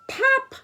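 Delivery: very high, loud pop; popping sound. Retold or imitated. Grandma making the balloon-like pop sound for the kids. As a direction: burst out the pop of the ball. Ball pops in story.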